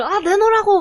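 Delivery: loud, high mock demand. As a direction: act out a tug-of-war opponent. Women fighting over the towel.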